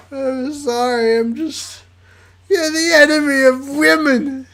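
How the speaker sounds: high, loud, slow sob-talk; Jaden crying. Acted. Jaden begging Sam for help, crying hard. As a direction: blubber the plea through tears. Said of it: "the person who I voice as if she's constantly crying".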